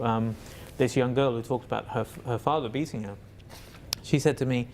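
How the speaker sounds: ordinary delivery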